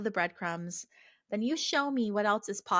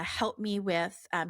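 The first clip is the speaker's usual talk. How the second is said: ordinary talk, nothing marked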